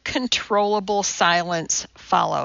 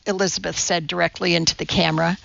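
plain reading voice

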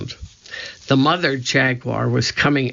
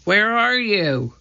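stretched call across a distance; 7 semitones higher than usual. A narrator's reading. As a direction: call to someone you can't see. Searching, calling through the forest.

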